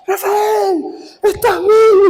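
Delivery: very high wailing cry, acted; sister screaming. Sister learning her brother is alive, high tearful mock scream. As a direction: imitate a sister shrieking through tears. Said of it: "Y se pone a gritar".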